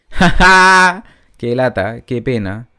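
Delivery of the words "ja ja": loud, punched-out laugh. Real, not acted. Gamer reacting to the game's narration, loud laugh. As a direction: burst out with a big "ha ha".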